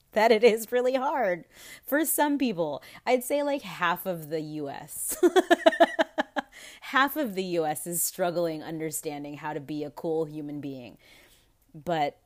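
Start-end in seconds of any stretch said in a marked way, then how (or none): 5.2-6.8 loud, high cackling laugh; real laugh. Real, not acted. Laughing about half of the U S, loud high cackle.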